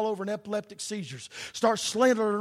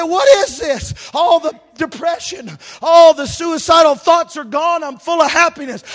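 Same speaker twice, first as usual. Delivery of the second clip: much higher, louder astonished cry; amazed joy; 7 semitones higher, 13 dB louder than usual. Retold or imitated. Young man just saved, crying out in amazed joy. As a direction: burst out in wonder at the change.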